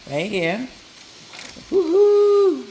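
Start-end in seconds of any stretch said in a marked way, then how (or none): 1.7-2.6 high joyful woo-hoo shout; excited whoop. Real, not acted. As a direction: cheer it out loud and high. Bread goes into oven.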